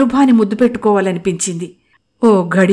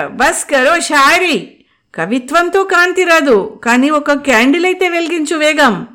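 higher, brusque and impatient; curt; 8 semitones higher, 3 dB louder than usual. Acted. Anu cutting off Mukul's poetry, curt impatient retort. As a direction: snap at the poet to stop. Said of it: "అంది దురుసుగా".

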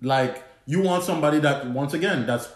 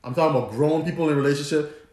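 ordinary talk

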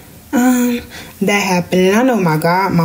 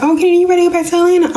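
teasing relatives; 12 semitones higher, much faster than usual. Retold or imitated. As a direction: tease her about leaving.

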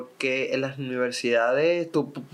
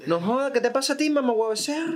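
mock malandrita; 10 semitones higher than usual. Imitating a Caracas malandrita.

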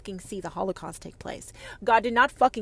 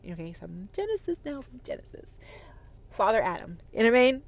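ordinary delivery

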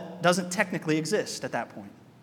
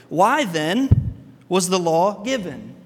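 slow and louder, each word weighed; slow rhetorical question as Paul; 2 semitones lower, 4 dB louder, slower than usual. Retold or imitated. Paul to the Galatians, slow louder rhetorical question. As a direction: ask it slowly to make them think. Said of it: "Paul stands there and he goes".

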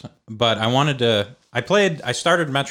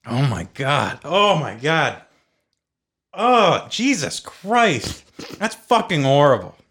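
disgusted shock; 4 semitones higher, slower than usual. Real, not acted. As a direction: recoil at the awful taste. Tasting the sour drink.

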